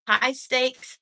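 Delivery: ordinary delivery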